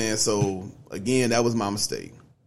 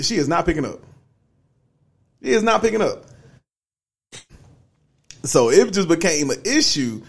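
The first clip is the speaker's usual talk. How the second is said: usual speaking voice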